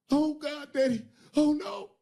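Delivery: very high, loud mock panic; panicked wail. The bottom bitch panicking to Daddy over the dead man, very high. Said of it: "panic after the death".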